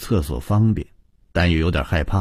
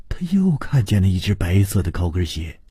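ordinary delivery, nothing marked

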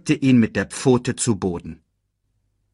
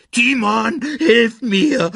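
high, loud squeal for help; 6 dB louder, slower than usual. A narrator's reading. Pumba spots the hungry lioness, squealing for help. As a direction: squeal in panic for your friend. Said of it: "quiekte er".

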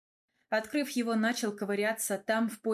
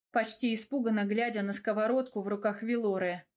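ordinary delivery, nothing marked

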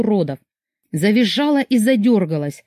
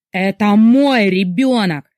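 slow loud furious yell, stress on мой; bellowing rage at his wife; 4 dB louder, slower than usual. A narrator's reading. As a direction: roar it out, leaning on мой. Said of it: "заорал Мурат".

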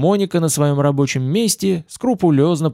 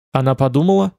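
ordinary delivery, nothing marked

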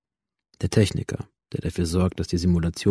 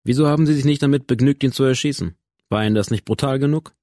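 lightly voiced interrogator, higher and pressing; a hint of a cold questioning commissioner; accusing interrogator; 7 semitones higher, 4 dB louder than usual. (leicht angedeuteter, bohrender Kommissar)

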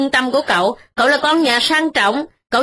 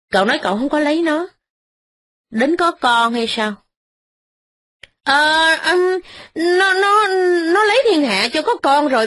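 flustered stammering; 3 semitones higher than usual. Acted. Young master caught by Ba Cam, stammering his denial. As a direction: caught out and scrambling to deny it.

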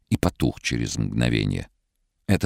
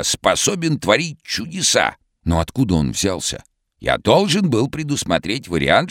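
hoarse, very high cartoon shout; vintage cartoon voice; 9 semitones higher, 3 dB louder than usual. A narrator's reading. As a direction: call out like an old cartoon character. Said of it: "хриплым, как в старом мультике".

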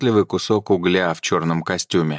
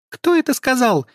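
very high, scared elf squeak; frightened squeak; 4 dB louder than usual. A narrator's reading. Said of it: "пропищал эльф".

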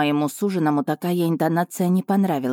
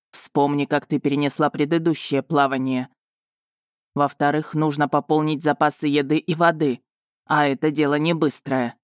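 lightly voiced dragon. A narrator's reading. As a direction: play the dragon steady and sure.

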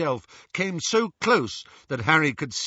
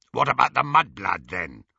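growled; 5 dB louder than usual. A narrator's reading. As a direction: growl it out loudly, dropping at the end. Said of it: "growled Greyback".

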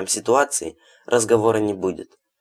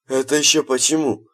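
surprised; 6 semitones higher than usual. A narrator's reading. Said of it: "удивился Хладов".

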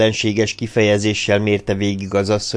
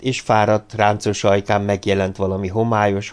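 usual speaking voice

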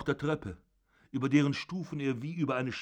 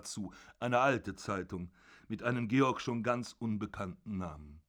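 usual speaking voice

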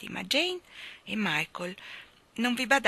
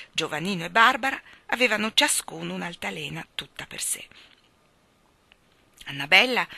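ordinary delivery, nothing marked

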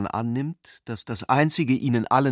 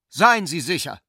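louder and higher, thrown across; called out; 6 semitones higher, 6 dB louder than usual. A narrator's reading. Hans calls out to Ulrich, louder and higher. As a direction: call it out at him. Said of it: "rief er ihm zu".

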